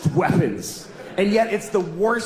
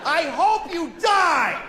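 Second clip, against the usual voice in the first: high, slow mock shout; road rage yell; 10 semitones higher, slower than usual. Driver cursing someone who drifted, high mock shout. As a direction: hurl it at another driver. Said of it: "I said that to a person".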